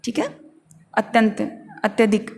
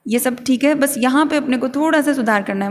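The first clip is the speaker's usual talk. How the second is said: normal talking voice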